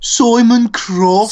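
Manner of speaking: put-on drawn-out, high Brummie accent; Birmingham accent. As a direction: stretch it out in a mock Birmingham accent. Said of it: "that's Birmingham".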